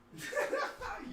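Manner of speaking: high laugh. Real, not acted. Laughs at the dog.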